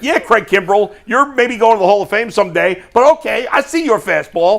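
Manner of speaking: brash, needling mock confidence; cocky retold challenge. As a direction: imitate a rookie unfazed by a star closer. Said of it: "and he's like".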